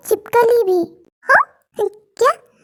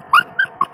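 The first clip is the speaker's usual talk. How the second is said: very high-pitched giggle; giggling. Acted. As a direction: lose it in squeaky giggles. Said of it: "हंसना बंद कर". (दबी न रहने वाली ऊँची हँसी)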